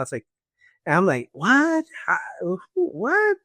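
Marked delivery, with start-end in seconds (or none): 1.3-3.4 high, startled questions, barely believing it; shocked disbelief. Retold or imitated. Waking patient hearing his stomach is open, shocked. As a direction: blurt it out in shock.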